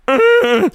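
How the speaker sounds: short high loud grunt of pleasure; sudden high grunt of pizza satisfaction; satisfied grunt. Retold or imitated. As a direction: let out a blissful grunt to yourself. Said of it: "I make this noise".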